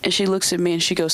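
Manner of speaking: ordinary talk, nothing marked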